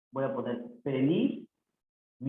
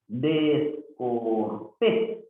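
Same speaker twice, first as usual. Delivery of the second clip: "Descortés" very slowly, pausing between syllables; 3 semitones lower, much slower than usual. Shown as a demonstration. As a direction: stretch out "Descortés", rising on the last syllable. Teacher sounds out word for accent. (sílaba por sílaba, muy lento)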